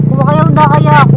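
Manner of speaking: loud call to the neighbours. Real, not acted. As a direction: call out to them. Calling to neighbours.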